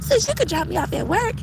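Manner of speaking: lightly high cheerful sister voice; a hint of a chirpy helper; imitating sis. Quoting her sister offering the car, light high voice. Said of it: "She was like".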